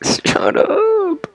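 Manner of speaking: very high laughing shriek; shrieking at an enemy while laughing; laughing squeal. Real, not acted.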